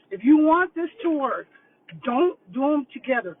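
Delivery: loud, with weight on "don't"; serious warning. Real, not acted. Insisting to viewers they do boards apart, firm and loud. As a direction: press the point hard. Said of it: "And I'm serious".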